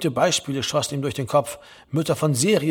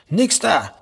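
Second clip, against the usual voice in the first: sudden higher-pitched irritated protest; flaring up; 4 semitones higher than usual. A narrator's reading. Grumpy son cutting off his mother, flaring up. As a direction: burst in with a touch of temper. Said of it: "brauste Herr Lehmann auf".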